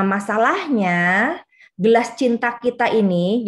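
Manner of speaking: ordinary delivery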